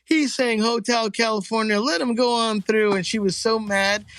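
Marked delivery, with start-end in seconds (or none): 0.0-2.9 approving cop. Retold or imitated. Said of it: "the other cops look around and goes".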